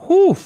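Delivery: sudden high whoop of a whew; loud whew. Real, not acted. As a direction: blow out a shocked, high whew. Reacting to the new teaser.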